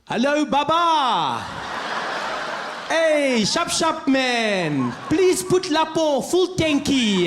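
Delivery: loud, high, hammed-up African accent; mock put-on African accent. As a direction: overdo the African accent, stretching every phrase.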